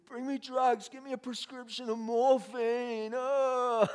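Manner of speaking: high, loud mock wailing; hammed-up desperate demand. Addict asks pastor for doctors' morphine, mock agonised wail. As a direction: overact the begging, ending in a moan.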